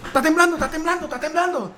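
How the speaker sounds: high, loud alarmed yell; mock alarm shout. Family jumping at any tremor, mock yell. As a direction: shout the warning in panic. Everyone panicking at chair moving.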